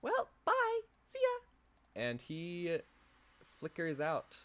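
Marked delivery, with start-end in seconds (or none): 0.0-1.4 high, bright-toned friendly guide voice; high NPC guide voice. Acted. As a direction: play the chirpy dungeon guide, pitched way up. Same guide NPC signs off.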